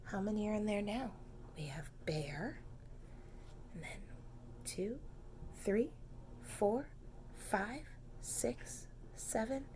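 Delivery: quick hushed whisper. Real, not acted. Reader whispers the count to the children. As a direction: whisper so the bear stays asleep.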